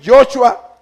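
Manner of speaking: Joshua said as "YO-chua"; vowel variant. Shown as a demonstration. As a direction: put an "o" in the first syllable of Joshua. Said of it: "usted puede decir".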